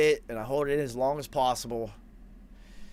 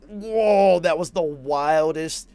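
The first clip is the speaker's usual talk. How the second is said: loud, slow, blown-away whoa; amazed whoa; 4 semitones higher, 7 dB louder, much slower than usual. Retold or imitated. Waking in the studio after the trip, stunned and loud. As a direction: let the amazement burst out.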